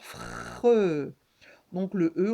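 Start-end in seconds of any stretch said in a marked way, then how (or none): 0.0-1.1 "fre" with long F, rolled into R and held vowel; blended ending. Shown as a demonstration. Teacher adding the R for pupils, drawn-out blend. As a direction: stretch out each sound of "fre". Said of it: "et encore un R".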